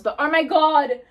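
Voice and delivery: ordinary delivery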